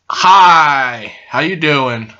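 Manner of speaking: loud, drawn-out greeting; hyper and loud. Real, not acted. Host suddenly greeting the camera, hyper and loud. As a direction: burst out with a hyper hello. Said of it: "I'm so hyper and loud".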